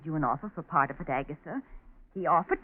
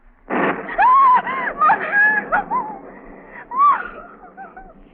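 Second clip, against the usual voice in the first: long shrill scream, much higher and louder; terrified shriek; 13 dB louder than usual. Acted. Niece terrified by a lightning flash, screaming. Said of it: "She's frightened to death of lightning".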